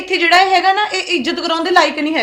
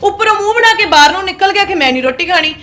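angry complaint; 4 dB louder than usual. Acted. Daughter-in-law complains angrily.

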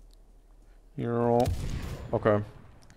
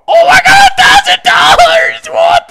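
ecstatic shriek; 11 dB louder than usual. Real, not acted. Huge money win.

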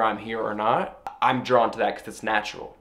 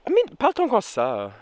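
a hammed-up French accent, pitched higher; bad French accent; 11 semitones higher than usual. Shown as a demonstration. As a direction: fake a clumsy French accent on purpose. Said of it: "the worst French accent I've ever done".

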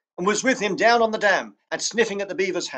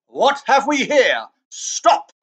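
slow, louder, then a sharp stop; commanding halt; 5 semitones higher, 4 dB louder, much slower than usual. Acted. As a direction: rein them in with authority. Witch halts the sledge.

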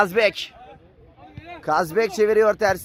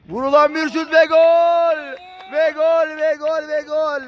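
repeated goal shout, pitched up; excited goal call; 3 semitones higher than usual. Real, not acted. (heyecanlı gol anonsu)

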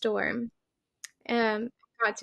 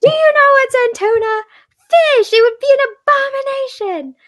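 put-on high, loud little-girl meltdown voice; imitating a child's furious tantrum; Lilo's tantrum; 12 dB louder than usual. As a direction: wail it like a six-year-old losing it. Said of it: "she has that big tantrum".

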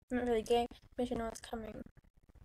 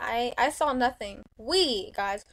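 loud; 8 dB louder than usual. Real, not acted. Telling players loudly.

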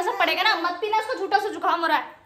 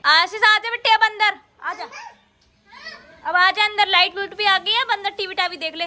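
loud, high summons from a mother; 6 semitones higher, 4 dB louder than usual. Real, not acted. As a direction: call out to your son to come inside. Calling son to come inside.

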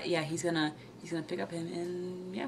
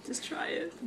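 whisper; 6 semitones higher, 8 dB quieter, slower than usual. Real, not acted. Whispered urging to viewers.

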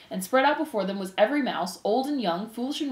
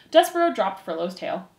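plain reading voice

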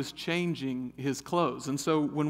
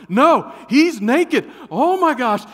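slow, loud, high horrified cry; horrified yell; 10 semitones higher, 14 dB louder, slower than usual. Retold or imitated. Son confirming to dad, horrified and loud. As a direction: drag it out in horror. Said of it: "I hear Cameron then go".

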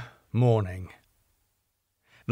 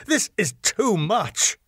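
higher, slow indignant outburst; rising up offended; sharp protest, held back; angry; 12 semitones higher than usual. A narrator's reading. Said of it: "angrily rising again".

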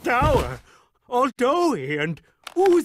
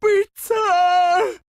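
desperate cry; 8 semitones higher, 3 dB louder than usual. Acted. Calls after pizza desperately.

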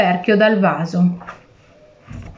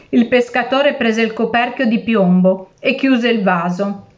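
usual speaking voice

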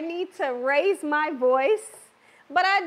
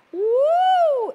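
excited whoop; 11 semitones higher, 6 dB louder than usual. Real, not acted. Excited over seasoned chops.

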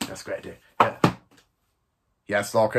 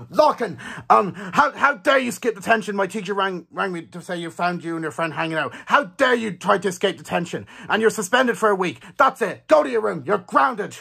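higher-pitched, indignant grounding; angry parent scolding; 3 semitones higher than usual. Acted. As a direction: tell off your child in acted outrage. Parent grounds child, acted quarrel.

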